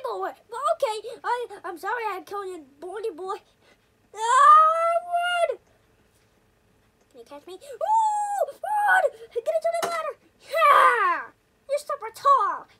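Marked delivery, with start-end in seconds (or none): 4.1-5.6 panicked scream. Real, not acted. Fleeing neighbor. 7.5-12.7 excited shouting. Real, not acted. Escaping up a ladder.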